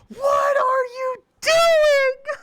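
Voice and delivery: high, slow, crying-out shout; anguished shout. Real, not acted. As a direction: wail it in despair at the screen. Screaming at a teammate's failure.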